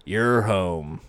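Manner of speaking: low, soft voice; Bruce Wayne. Acted. Father figure at the bedside, low and soft. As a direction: settle into a low, quiet register. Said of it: "Bruce Wayne sits next to him".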